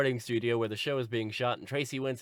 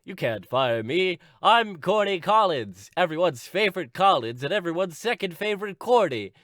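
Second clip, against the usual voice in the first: Corny Collins; 5 semitones higher, 6 dB louder than usual. Acted. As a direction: announce yourself grandly, stretching the words. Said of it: "And he's like".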